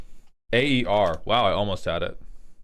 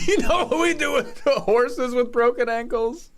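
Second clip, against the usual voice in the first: laughing through a dark joke; 12 semitones higher than usual. Real, not acted. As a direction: chuckle while threatening. Laughing menace at NPC.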